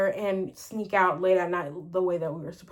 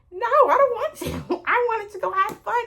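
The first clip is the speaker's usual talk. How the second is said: high, laughing mock protest; laughing whine; 12 semitones higher than usual. To her mom, a high laughing childish protest. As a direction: whine it back through a laugh.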